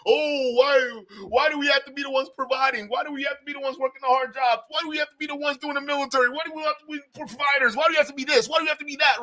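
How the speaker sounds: high, plaintive mimicked complaint; piling up pleading whys in parody; mock whining. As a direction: whine like an aggrieved man, mockingly. Mimics red pill men's complaints.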